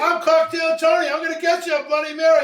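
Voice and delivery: loud, brash bartender persona entrance; bartender showman. Acted. Bartender answering the mirror summons, loud showman voice. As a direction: burst in like a cocktail showman.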